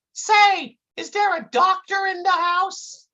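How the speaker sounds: put-on high-pitched kid's call. A narrator's reading. Intern calling through the office door, high voice. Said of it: "calls out".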